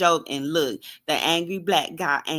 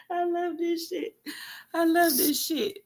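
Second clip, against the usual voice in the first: high, giddy and softer, laughing through it; gleeful squeal; 10 semitones higher, 3 dB quieter than usual. Real, not acted. Vlogger gloating over the news, giddy squeal. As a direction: squeal it with delight.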